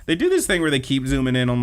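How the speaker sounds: ordinary delivery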